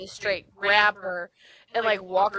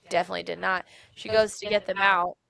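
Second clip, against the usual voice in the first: ordinary delivery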